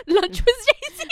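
high, drawn-out laughing shout; laughing retelling. Retold or imitated. Retelling a friend's Instagram story, in a high laughing shout. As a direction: crack up while crying out the quote.

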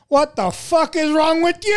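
high-pitched imitated old man yelling; old man's outrage. Imitating an old diner outraged at the chili mess, high mock yell. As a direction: mimic a scandalized old customer.

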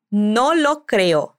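very slow and careful, each word apart; slow model phrase. Shown as a demonstration. Teacher modelling the phrase for learners, very slow. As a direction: say it slowly so learners can copy.

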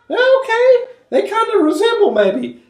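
high-pitched, tentative mock concession; squeaky-high put-on "maybe so"; doubtful onlookers.

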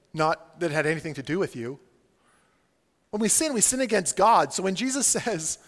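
ordinary delivery

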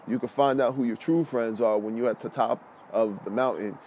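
ordinary talk

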